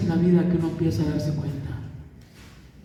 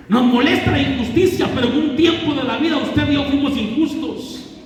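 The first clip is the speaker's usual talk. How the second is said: preaching at full force; 10 semitones higher than usual. Acted. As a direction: drive each line home to the congregation. Predicador sube de tono. (predicando con fuerza y fervor)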